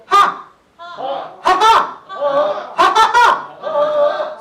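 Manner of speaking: loud, drawn-out ha ha ha laugh; big open laugh. Shown as a demonstration. Laughter coach demonstrating a wide-mouthed laugh to teachers, loud. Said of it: "입을 크게".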